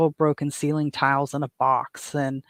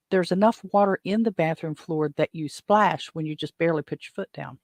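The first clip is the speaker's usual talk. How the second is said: normal talking voice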